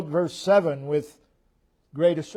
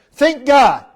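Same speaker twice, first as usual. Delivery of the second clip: 1 loud exhortation; 9 semitones higher, 11 dB louder than usual. Real, not acted.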